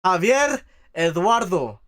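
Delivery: "Javier Eduardo" slow and loud with the R in Eduardo rolled; rolled R's. Shown as a demonstration. Showing the Puerto Ricans the right way, with rolled R's. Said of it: "That's how you say it".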